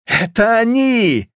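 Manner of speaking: high, long-drawn squeal; sexless shriek. A narrator's reading. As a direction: screech it out so nobody can tell man from woman. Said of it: "мужчина визжит или женщина".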